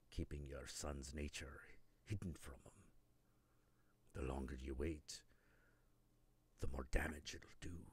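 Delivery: low, hushed near-whisper, threatening calm; Mimir, hushed and ominous. Retold or imitated. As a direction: Mimir quietly warning Kratos before his head is cut off, low hushed near-whisper. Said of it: "he's like".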